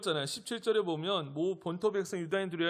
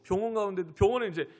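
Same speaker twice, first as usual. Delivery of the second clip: ordinary talk, nothing marked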